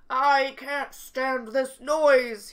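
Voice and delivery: loud, slow exasperated cry; exasperated outburst. Acted. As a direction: protest the racket loudly, stretching each word. Story character exasperated by noise.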